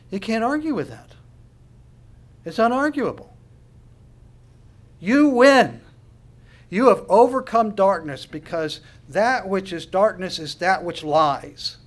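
high, loud, fervent preaching; preaching at full force. Acted. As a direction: preach it out with rising fire. Preaching climax, rising fervor.

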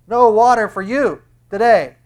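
high, loud denial; mock refusal. Preacher refusing water to the unaccepting, loud mock refusal. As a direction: turn them away emphatically. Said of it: "That's what I would say".

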